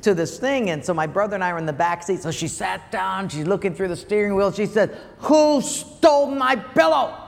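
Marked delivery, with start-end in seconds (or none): 5.2-7.1 high, loud, slow demand from mom; playing his mother hunting for her pillow; mock angry mother. Son imitating mom in the car, high and loud.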